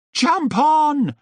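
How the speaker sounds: high, loud call to jump on; shouted. Acted. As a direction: call out urgently to a friend. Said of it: "they shouted to Sid".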